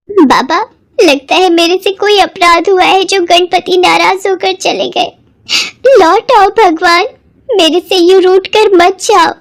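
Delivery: crying. Acted. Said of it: "Santoshi बहुत रोती है".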